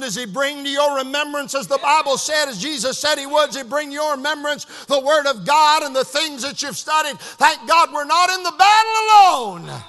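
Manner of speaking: loud, driving sermon delivery; full-force preaching. Real, not acted. Preacher to congregation about the Holy Spirit, full-force preaching. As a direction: preach it hard, building to a shout.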